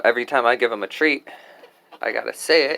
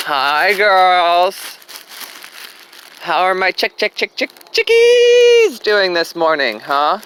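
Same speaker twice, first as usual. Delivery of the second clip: talking to pets; 6 semitones higher, 6 dB louder than usual. Real, not acted. Greeting her chickens sweetly.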